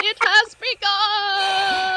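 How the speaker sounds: slow, high, loud villain proclamation; dramatic villain. Acted. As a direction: gloat it out like a villain. Dramatic show opening.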